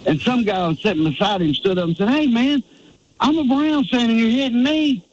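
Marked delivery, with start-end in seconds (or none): 2.1-5.0 higher-pitched imitation of a complaining fan; retold protest. Fan hit by stuff thrown at rival, retold protest. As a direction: mimic an aggrieved bystander, pitched up.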